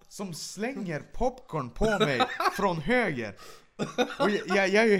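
telling it through real laughter, loud and high; laughing while telling about the popcorn. Real, not acted. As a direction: laugh your way through the words.

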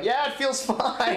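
high, slow, mock-casual girl voice; drawn-out bored reassurance; mock bored girlfriend. Mocking a bored partner.

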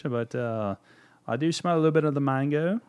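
ordinary talk, nothing marked

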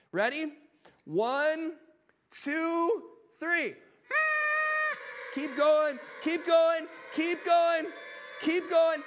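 loud, high count and cheering call over the crowd; calling out over noise. Real, not acted. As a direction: shout the count above the kazoos, keep urging them on. Shouting over kazoos and party noise.

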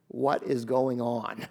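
soft, slow, hushed disbelief; bewildered hush. Pretend. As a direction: murmur it, stunned and low. Imagined bewildered listener.

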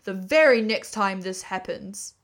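loud, gruff challenge at the door; gruff Badger, annoyed at late callers; gruff and suspicious. A narrator's reading. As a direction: growl a suspicious warning, loudly. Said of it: "said a gruff and suspicious voice".

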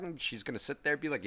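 normal talking voice

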